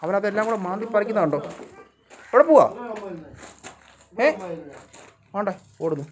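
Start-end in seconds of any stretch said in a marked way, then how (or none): 2.3-4.3 high, loud call; calling after. Real, not acted. Owner calling after a dog running away, high and loud.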